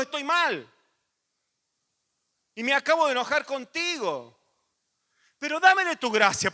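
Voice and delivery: loud, high, urgent preaching; fiery preaching. Acted. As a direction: preach it out to the congregation, full force. Preaching at full force.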